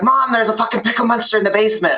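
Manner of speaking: high, frantic report; panicked girl. Retold or imitated. Girl running to mom, high frantic. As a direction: blurt it out to mom. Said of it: "and she's like".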